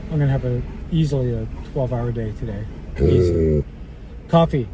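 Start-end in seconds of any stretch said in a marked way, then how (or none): 3.0-4.7 mock stern growl, louder, the first word low; anger voice. Scolding the barking dog in a mock angry voice. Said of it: "That's my anger voice".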